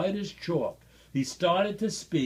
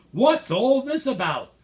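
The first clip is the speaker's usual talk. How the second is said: shouting; 7 semitones higher, 6 dB louder than usual. A narrator's reading. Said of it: "the people came shouting".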